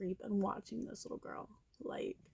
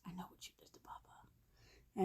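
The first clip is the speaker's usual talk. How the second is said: breathless whisper, low and hushed; whispered secret; 3 semitones lower, 11 dB quieter than usual. Retold or imitated. Girl whispering her secret into the postman's ear. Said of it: "Come whisper in my ear".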